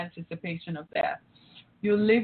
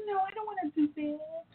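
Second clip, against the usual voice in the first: high and much softer, sulky "no"; mother pouting at her daughter, high and soft; pouty refusal; 8 semitones higher, 7 dB quieter than usual. Retold or imitated. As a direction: sulk it quietly like a child.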